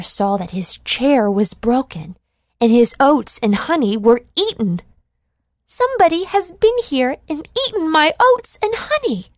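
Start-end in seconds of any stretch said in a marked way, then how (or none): 5.7-9.3 put-on high, fast baby bear voice; much higher and quicker cub voice, outraged; baby bear exclaiming. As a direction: squeak it out like an upset little cub. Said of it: "exclaimed the baby bear".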